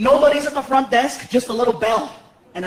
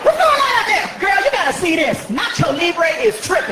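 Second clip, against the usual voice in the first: hollering clerk; 5 semitones higher than usual. Retold or imitated. Clerk hollers to coworker about the guest, high and sassy. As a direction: call to a coworker across the office. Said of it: "clerk calls to coworker".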